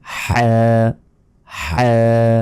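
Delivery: হ্যাঁ as Arabic "ḥaa", strong friction from a squeezed throat; constricted-throat ha, vowel held; pressed throat. Shown as a demonstration. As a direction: squeeze out a long rasped h in হ্যাঁ. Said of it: "চেপে ধরে উচ্চারণ করবেন".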